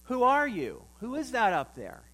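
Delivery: yelling back. Acted. Man on the branch questions the voice above, yelling back. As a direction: call up to an unseen voice, questioning. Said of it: "he yelled back to the voice".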